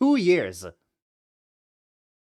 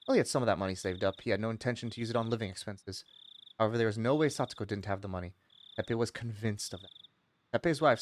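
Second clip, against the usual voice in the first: quiet, steady narration of the novel; story narration; 10 semitones lower, 9 dB quieter, faster than usual. Acted. Streamer narrating the visual novel to chat, quiet reading voice. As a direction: read it out like a calm narrator. Reads the game text aloud.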